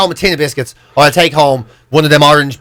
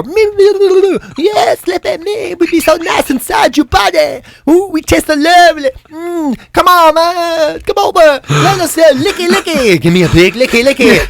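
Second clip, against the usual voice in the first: much higher, slow, seductive little voice; a chocolate calling sweetly from the drawer; chocolate in the drawer luring him, high and slow; high tempting chocolate voice; 10 semitones higher, slower than usual. Acted. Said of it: "I can hear the chocolate in the drawer".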